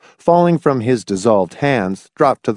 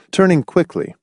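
ordinary delivery

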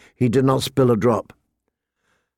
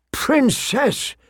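high, hushed reverent gasp of a title; awed merchant; 12 semitones higher than usual. Acted. Merchant dropping to his knees before royalty, awed. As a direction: draw the word out high, in awe.

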